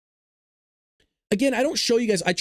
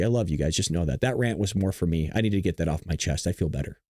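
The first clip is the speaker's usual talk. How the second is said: much lower and softer, settling after the rant; calmed down; 13 semitones lower, 4 dB quieter than usual. Real, not acted. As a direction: exhale the anger and speak gently to viewers.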